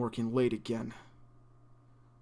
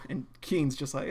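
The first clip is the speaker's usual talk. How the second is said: usual speaking voice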